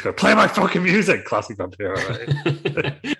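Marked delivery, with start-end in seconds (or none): 0.2-1.2 loud, high shouted demand; angry yell. Acted. Vampiro yelling at the barista for his music. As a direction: bellow it like a furious wrestler.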